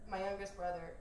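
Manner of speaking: ordinary talk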